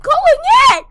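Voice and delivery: high, loud, fast yell; frustrated shout. Real, not acted. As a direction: yell it out impatiently. Impatient in game lobby.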